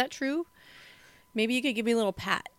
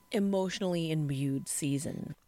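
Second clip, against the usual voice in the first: ordinary talk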